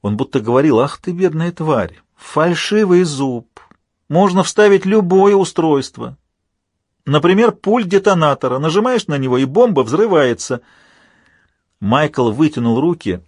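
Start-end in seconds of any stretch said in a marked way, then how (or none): 0.8-10.6 unhinged villain. A narrator's reading. Villain mocking hero, imagined voice.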